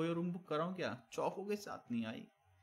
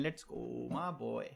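drawn-out catchphrase; 4 semitones lower, 4 dB quieter, much slower than usual. Real, not acted. Streamer thanking a donor, very slow drawn-out. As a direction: drag every word out.

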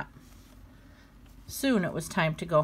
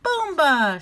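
high, loud game cry; playful shout; 9 semitones higher, 7 dB louder than usual. Acted. Flip shouting his game word, loud and playful. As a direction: yell it while bouncing. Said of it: "shouted Flip".

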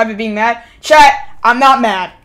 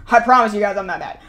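normal talking voice

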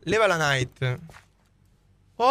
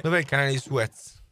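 normal talking voice

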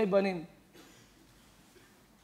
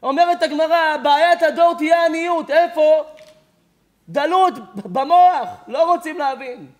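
preach; 6 semitones higher, 6 dB louder than usual. Acted. Preaching rising to full force.

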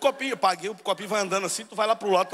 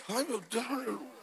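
high, hushed exclamation; soft devout sigh; 4 semitones higher, 10 dB quieter, slower than usual. Acted. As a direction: murmur it in mock devotion, high and soft. Acts out a worshipper's soft exclamation. (aleluia suspirada, baixinho)

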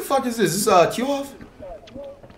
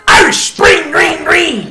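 excited shout; 11 semitones higher, 5 dB louder, much slower than usual. Real, not acted. Hype shout after a made three.

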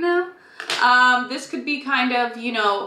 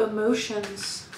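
theatrical hush; 7 dB quieter, slower than usual. Acted. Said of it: "I'm being extra theatrical today".

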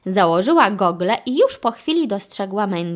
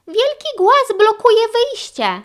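very high, loud little-character voice; urgent high-pitched report; Sky reporting; 13 semitones higher, 5 dB louder than usual. A narrator's reading. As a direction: sound the alert in a high voice. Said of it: "zaraportowała Sky Ryderowi".

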